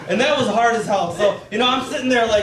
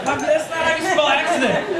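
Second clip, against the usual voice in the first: son's protest; 6 semitones higher than usual. Retold or imitated. Son protesting dad's wrong spelling.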